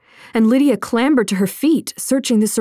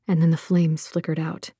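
usual speaking voice

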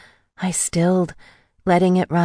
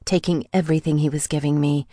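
ordinary delivery

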